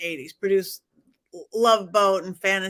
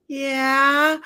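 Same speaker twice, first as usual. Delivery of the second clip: "Yeah" squeaked out higher and tense; nervous higher voice; 4 semitones higher, 4 dB louder than usual. Retold or imitated. Caught by her bank client, a nervous high-pitched yeah. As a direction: let the voice creep up in nervous panic. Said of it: "my voice is getting higher and higher".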